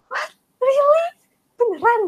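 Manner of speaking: high, shocked questions; mock disbelief. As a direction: sound stunned and disbelieving. Shocked reaction retold.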